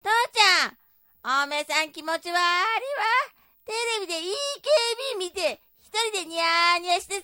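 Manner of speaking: high-pitched, needling dialect wife; nagging wife in a skit. Acted. Wife scolds husband for grinning at TV, nagging. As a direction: pick on your husband in a big stage voice. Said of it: "でけえ声出して".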